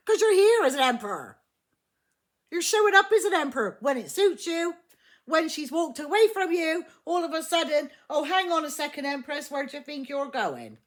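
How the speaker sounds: loud, high-pitched telling-off; indignant scolding. Acted. Scolding the Emperor, voicing his demand.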